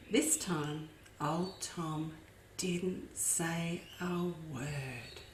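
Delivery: quiet tension as Tom sneaks up; hushed. A narrator's reading. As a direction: drop to a hush. Tom keeping silent.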